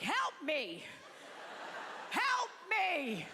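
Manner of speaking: high, drawn-out fake cry for help; mock elderly yell. Old audience member yells during the show, mock call. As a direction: imitate an old heckler calling out. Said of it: "They yell shit out".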